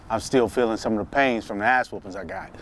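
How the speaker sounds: ordinary talk, nothing marked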